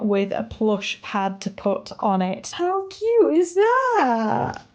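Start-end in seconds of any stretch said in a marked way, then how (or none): 2.5-4.6 high, drawn-out coo of delight. Real, not acted. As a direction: gush slowly over something adorable. Gushing over the finished pet bed.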